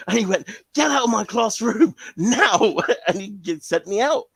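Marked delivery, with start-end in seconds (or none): 0.8-2.8 loud, high, slow command; angry order. Retold or imitated. Teacher ordering the boy out, loud and high. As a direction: throw the kid out of class.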